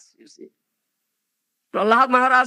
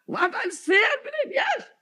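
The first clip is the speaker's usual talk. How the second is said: high shouted motto; 10 semitones higher, 4 dB quieter than usual. Retold or imitated. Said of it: "I have this motto".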